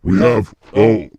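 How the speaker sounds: a big demon proudly touring hell; low loud demon. Pretend. Second demon shows off hell.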